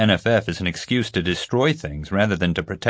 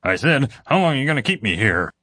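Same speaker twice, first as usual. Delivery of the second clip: higher-pitched upset demand; upset, insistent; 6 semitones higher than usual. A narrator's reading. Ignored prisoner repeats his question to the officer, upset. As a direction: press the question, agitated. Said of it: "sounding more upset this time".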